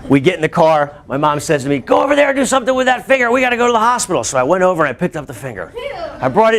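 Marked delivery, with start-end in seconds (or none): 1.8-4.2 urgent mom. Retold or imitated. Mom ordering in panic.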